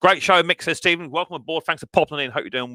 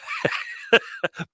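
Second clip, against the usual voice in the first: breathy burst of laughter; real amused chuckle; 7 semitones higher, 6 dB quieter than usual. Real, not acted. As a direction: laugh to yourself at the joke. Amused by password story.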